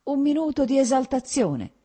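high, loud, insistent appeal; urgent pleading. A narrator's reading. Husband excusing his lapse to wife, urgently pleading.

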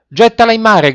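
loud, high-pitched command; sailors' shout. A narrator's reading. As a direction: yell the order urgently to the boy. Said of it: "gridarono i marinai".

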